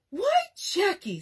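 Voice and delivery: high, slow, trembling dismay; mother near tears, drawn out and high; almost in tears. Acted. Mother, shocked at Jack, almost in tears. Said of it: "almost in tears".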